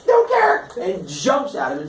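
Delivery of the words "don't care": very high, loud yell; hammed-up bratty shout; defiant interruption. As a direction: cut the speech off with a squawk. Son interrupts father's curse.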